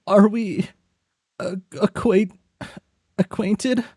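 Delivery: high, startled questioning voice; high confused caller. Acted. A confused friend on the phone asking who is calling, high and puzzled. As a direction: sound baffled on the phone.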